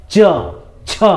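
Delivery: च, छ with the tongue touching the palate; isolated palatal च, then छ, with a pause between; palatal sounds demonstrated. Shown as a demonstration. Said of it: "जीभ जो है हमारे ऊपरी भाग में टच".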